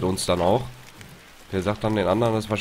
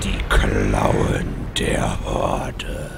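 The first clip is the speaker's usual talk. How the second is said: much lower, very slow movie trailer voice; deep trailer voice; 11 semitones lower, 5 dB louder, much slower than usual. Acted. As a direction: intone it like an epic trailer. Deep dramatic title voice. (tiefe, langsame Trailerstimme)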